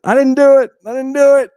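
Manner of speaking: high, loud, frantic protest; mock screaming. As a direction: imitate a man screaming his innocence. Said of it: "he's already been screaming".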